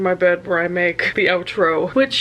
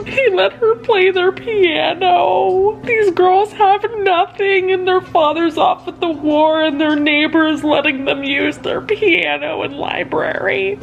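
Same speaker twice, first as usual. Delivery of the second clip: real crying; 9 semitones higher than usual. Real, not acted. Moved to tears reading Little Women, crying while she speaks. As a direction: choke the words out through tears. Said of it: "I'm sitting here bawling".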